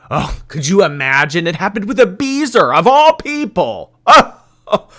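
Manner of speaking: loud, climbing fake astonishment; mock shock. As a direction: act scandalised, pitch soaring to loud gasped ohs. Mock shock at predictable betrayal.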